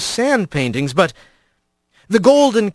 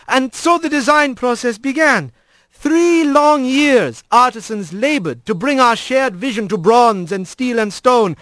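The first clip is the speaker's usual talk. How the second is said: higher, grand ceremonial speech; squirrel priest voice; 6 semitones higher than usual. A narrator's reading. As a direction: proclaim like a proud old priest. Alphonse's dedication speech.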